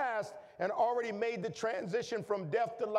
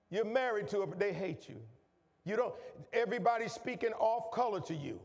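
ordinary talk

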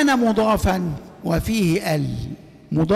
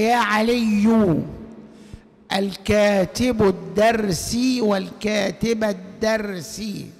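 slow recitation; much slower than usual. Shown as a demonstration. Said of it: "repeats example".

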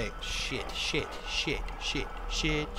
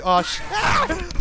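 high cry into breathless laugh; startled cry; 10 semitones higher than usual. Real, not acted. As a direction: yelp then giggle. Jump scare at the end.

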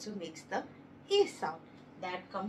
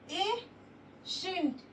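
ordinary talk

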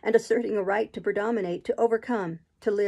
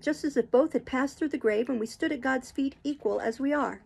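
impassioned protest; 4 semitones higher than usual. Acted. Jane confronts Rochester with fierce passion. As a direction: pour it out, demanding to be seen as equal. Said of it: "roused to something like passion".

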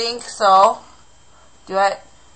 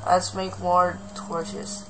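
ordinary talk, nothing marked

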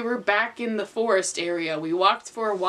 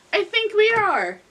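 high voice; 10 semitones higher than usual. Acted. Voicing character high.

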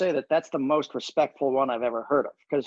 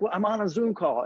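higher, imitated protest to his wife; protesting husband; 7 semitones higher than usual. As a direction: plead that now is a bad time, pitched up.

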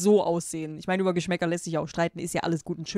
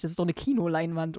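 ordinary talk

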